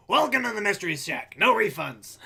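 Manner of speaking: lightly voiced gruff shopkeeper; Stan impression. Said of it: "I can kinda do Stan".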